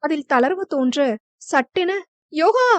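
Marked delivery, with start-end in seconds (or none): 2.3-2.7 shout. A narrator's reading. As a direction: scream his name in fear. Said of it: "என்று கத்திவிட்டாள்". (பயத்தில் உரத்த அலறல்)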